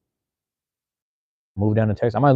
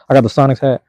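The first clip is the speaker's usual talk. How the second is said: louder and higher, proudly showing it off; excited show-off; 5 semitones higher, 9 dB louder than usual. Real, not acted. To chat viewers while holding up his hat, an excited louder announcement. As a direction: hold up the hat and announce it with glee.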